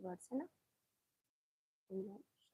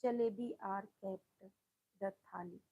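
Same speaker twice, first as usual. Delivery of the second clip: slow dictation; much slower than usual. Shown as a demonstration. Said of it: "अब आ गया number नौ पे".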